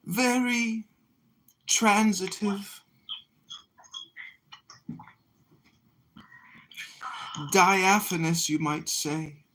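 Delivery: high, slow, dreamy stage voice; drawn out, grandly theatrical. Acted. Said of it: "It's a theatrical voice".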